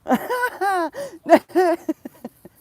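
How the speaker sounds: long, high cackle of laughter. Real, not acted. Laughing fit.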